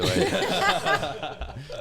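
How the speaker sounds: laughing. Real, not acted. Teasing remark.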